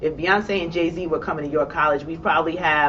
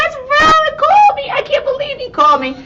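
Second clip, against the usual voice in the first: much higher, louder squealing scream of joy; excited screaming; 7 dB louder than usual. Retold or imitated. On seeing Les Brown's voicemail, screaming with joy. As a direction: scream it like you nearly crashed the car. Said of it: "waited for me to stop screaming".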